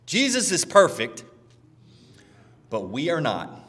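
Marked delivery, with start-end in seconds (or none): none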